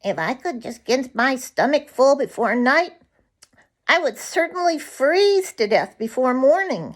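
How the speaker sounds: a frozen bird glad of food; nuthatch voice. Acted. Yank Yank the nuthatch speaks.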